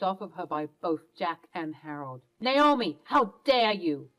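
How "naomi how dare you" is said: angry rebuke. Acted. Husband scolding Naomi.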